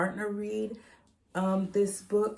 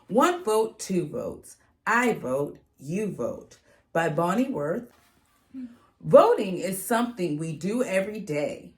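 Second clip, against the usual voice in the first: bright, louder storybook read-aloud; reading to children; 3 semitones higher, 7 dB louder than usual. Acted. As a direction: read it out to a class of kids with energy. Reads picture book aloud to kids.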